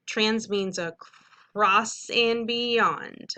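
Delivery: teacher introducing a word part slowly; slow vocab teaching. Shown as a demonstration. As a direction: spell out the meaning slowly for kids.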